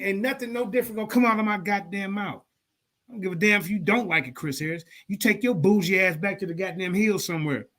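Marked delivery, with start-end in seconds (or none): none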